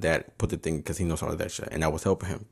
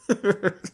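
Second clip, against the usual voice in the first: a quick burst of laughter, much higher; short high laugh; 12 semitones higher than usual. Real, not acted. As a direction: let out a short amused laugh. Laughing at the thief's bad luck.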